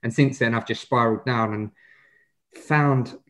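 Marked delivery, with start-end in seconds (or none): none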